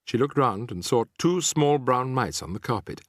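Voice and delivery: ordinary delivery, nothing marked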